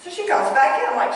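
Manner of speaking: normal talking voice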